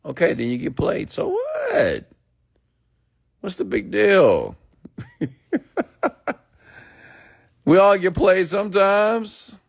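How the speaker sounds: high, cracking laugh through the words; squealing with laughter at the idea; laughing mockery. Real, not acted. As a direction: laugh off their fear. Mocking the fear of being played.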